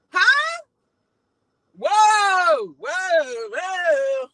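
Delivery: high, loud startled huh, then whoa fading down; shocked whoa. Real, not acted. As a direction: stop everything in disbelief. Offended by guest's remark.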